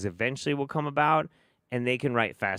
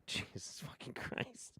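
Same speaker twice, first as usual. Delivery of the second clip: exasperated whisper; 18 dB quieter than usual. Real, not acted. Exasperated after laughing at clip.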